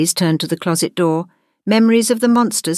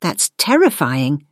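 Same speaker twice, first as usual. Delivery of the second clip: usual speaking voice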